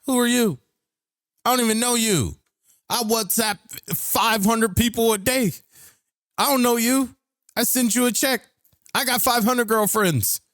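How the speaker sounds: high, exaggerated parody of the caller; mocking the scammer. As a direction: pitch it way up and ridicule him. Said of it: "He's like".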